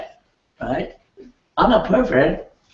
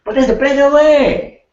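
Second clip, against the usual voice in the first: louder, higher, angry push; angry demo; 11 semitones higher, 7 dB louder than usual. Shown as a demonstration. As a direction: raise the voice as if angry at the client. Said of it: "I'm angry now".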